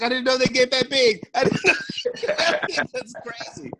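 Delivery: high, laughing "I didn't know they get that big"; laughing disbelief. Retold or imitated. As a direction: laugh through the shock. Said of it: "I was like".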